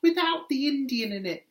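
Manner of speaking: a hint of a cheerful tourist; mock tourist. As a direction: toss it off breezily like the woman at the view. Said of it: "asks her husband".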